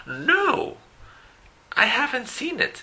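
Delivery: voz mucho más aguda de otra persona; respuesta del alumno. Acted. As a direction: hace de alumno que no la ha visto. Alumno responde en el ejemplo. (el alumno responde que no ha visto la película, voz aguda)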